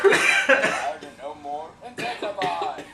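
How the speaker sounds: loud announcer. Acted. Trailer parody.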